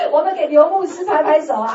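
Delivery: loud, high call through laughter; rally the crowd to clap while laughing; laughing call for applause; excited call. Real, not acted. Calling for applause.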